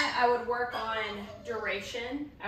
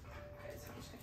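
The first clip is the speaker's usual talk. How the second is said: whispering; 17 dB quieter than usual. Real, not acted. Whispered praise.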